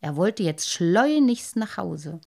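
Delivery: ordinary delivery, nothing marked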